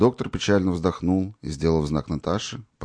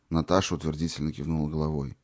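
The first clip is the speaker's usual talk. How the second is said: ordinary delivery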